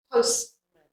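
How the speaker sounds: Postman with a faint "mun" ending; schwa. Shown as a demonstration. Teacher leading learners through Postman, schwa ending. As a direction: softly model Postman, last vowel just "uh". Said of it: "Can you say it with me?".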